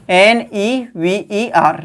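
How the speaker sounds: letter by letter, slowly; spelling aloud. Shown as a demonstration. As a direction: spell it out for the students. Teacher spells the word. (अक्षर-अक्षर बोलना)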